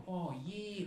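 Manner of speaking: chilled Australian drawl, imitated; really chill Australian. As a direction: sound utterly unbothered, in Australian. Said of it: "Really chill".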